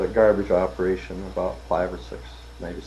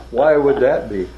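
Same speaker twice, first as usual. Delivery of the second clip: louder, higher retold challenge; real indignant; 4 semitones higher, 7 dB louder, slower than usual. Boss questions rep's bigger expense account, retold indignant challenge. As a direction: demand it like an affronted boss. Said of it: "looked at me real indignant".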